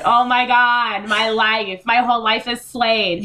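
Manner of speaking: fangirling. Retold or imitated. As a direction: gush over it like a superfan. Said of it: "and I was like".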